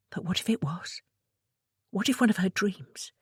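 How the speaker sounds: soft unvoiced whisper. A narrator's reading. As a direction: breathe the words out. Inner thought whispered.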